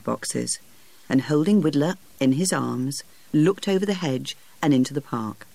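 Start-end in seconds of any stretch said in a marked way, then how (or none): none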